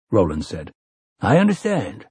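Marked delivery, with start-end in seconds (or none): 1.2-2.1 hasty. Acted. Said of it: "Callahan said hastily".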